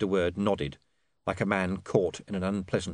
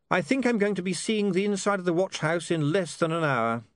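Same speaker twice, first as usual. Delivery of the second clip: lightly voiced, higher-pitched young man; resigned young man; 10 semitones higher than usual. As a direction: predict your own trouble wryly.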